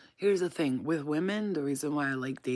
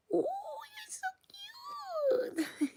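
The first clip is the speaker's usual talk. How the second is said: very high, soft squealed coo; cute squeal; 6 dB quieter, much faster than usual. Real, not acted. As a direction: squeak it softly, melting over a pet. Squealing over the pet.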